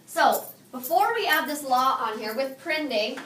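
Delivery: usual speaking voice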